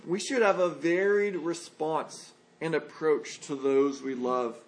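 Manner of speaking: ordinary delivery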